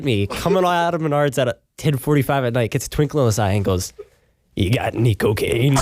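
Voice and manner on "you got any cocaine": a shady guy's lightly voiced offer; sketchy dealer voice. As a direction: play the rain-soaked stranger asking for coke, only lightly put on. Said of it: "In that voice?".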